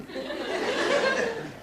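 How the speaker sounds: high-pitched cackle breaking out; high laugh. Real, not acted. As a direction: let a high laugh burst. Laugh after gossip.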